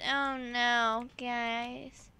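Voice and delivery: usual speaking voice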